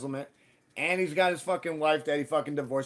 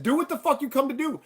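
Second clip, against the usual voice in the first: higher, louder defiant retort; retold defiance; 12 semitones higher, 6 dB louder than usual. Telling the drunk men at the door to make their move, defiant. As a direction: throw the dare back at them hard.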